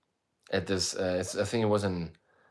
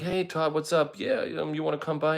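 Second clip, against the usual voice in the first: much higher, bright retold hello; playful raised voice retelling a call; high mock greeting; 8 semitones higher than usual. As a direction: squeak out the friendly hello.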